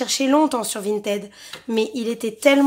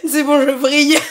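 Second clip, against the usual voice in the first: laughing; 8 dB louder than usual. Real, not acted. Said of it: "Mon cerveau il vrille".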